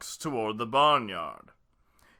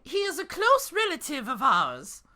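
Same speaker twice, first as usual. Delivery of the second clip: high put-on she-wolf voice; 3 dB louder than usual. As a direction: pitch it way up, mock female wolf. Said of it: "she said".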